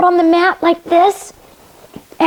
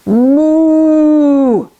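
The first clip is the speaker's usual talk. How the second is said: long, louder mooing cow; cow's moo; 3 dB louder than usual. Acted. Story cow mooing at passing Duck, long and loud. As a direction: stretch out a big moo. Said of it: "said cow".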